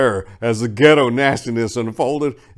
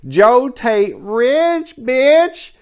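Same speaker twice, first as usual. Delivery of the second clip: high, loud and drawn-out hype call; hype shout; 14 semitones higher, 7 dB louder, much slower than usual. Acted. Host hyping the book sales to the audience with a loud punchline. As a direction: sell the punchline big.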